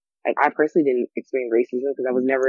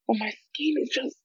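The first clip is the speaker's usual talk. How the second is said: high, fussy doting voice; precious fussing; 10 semitones higher than usual. Pretend. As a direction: coo over your own skin. Fussing over her skin.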